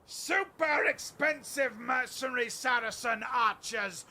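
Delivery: loud battlefield order, forward bellowed; commanding shout. Acted. Duke commanding his mercenary archers, a loud bellowed order. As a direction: roar the order to advance.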